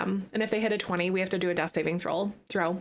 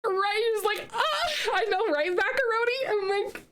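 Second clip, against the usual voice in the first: high, squealing laugh-shouts; shrieking through laughter at a dice roll; 13 semitones higher than usual. Real, not acted.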